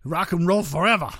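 a hint of rowdy rock star; mock rock star. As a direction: toss it out like a hammed-up rock slogan.

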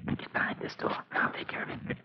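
whisper. Acted. Hiding from the intruder.

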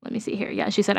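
ordinary talk

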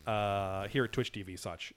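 ordinary delivery

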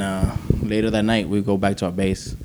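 ordinary talk, nothing marked

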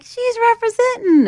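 high, loud imitated group cheer; teasing exclamation. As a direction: call it out high as the amused crowd.

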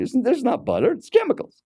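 high-pitched, exasperated correction; scornful rant. Retold or imitated. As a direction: imitate an indignant food zealot. Retelling seatmate's scornful food rant.

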